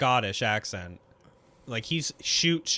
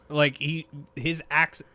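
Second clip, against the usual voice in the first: ordinary talk, nothing marked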